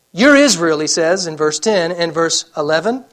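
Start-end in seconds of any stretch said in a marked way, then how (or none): 0.1-0.8 loud, forceful proclamation; preacher voicing God at full volume; God's emphatic declaration. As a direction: thunder it out as God speaking. Quoting God forcefully to Jacob.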